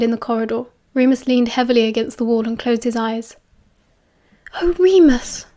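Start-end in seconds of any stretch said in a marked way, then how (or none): 4.6-5.5 higher-pitched, worried exclamation; anxious, raised-pitch sympathy; anxious concern. A narrator's reading. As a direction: fret over an unwell friend. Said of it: "Lily said anxiously".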